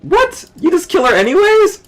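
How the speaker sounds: high loud disbelief; shocked outburst. Real, not acted. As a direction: shriek in disbelief.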